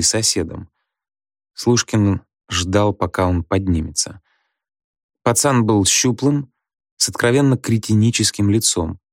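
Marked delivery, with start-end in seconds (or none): none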